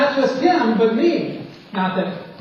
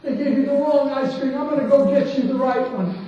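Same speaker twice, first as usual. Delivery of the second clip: owner's voice raised high, rushing through the apology; apologetic owner, voiced higher; 7 semitones higher than usual. Restaurant owner apologizing to the boy over the wrong ice cream, higher and quick. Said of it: "And he said".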